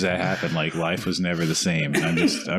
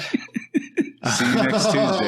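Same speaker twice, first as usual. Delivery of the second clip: laughing; 6 semitones higher, much slower than usual. Real, not acted. Teasing repeat of the phrase.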